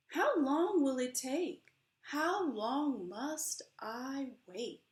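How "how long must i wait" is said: usual speaking voice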